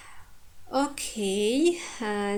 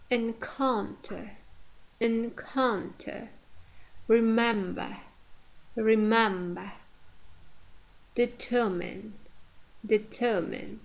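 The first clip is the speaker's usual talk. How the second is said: careful "encounter, remember, determine", stressing "coun", "mem", "ter"; teacher's deliberate "encounter, remember, determine", each stressed in the middle; stress on second syllable. Shown as a demonstration. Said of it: "stress on penultimate".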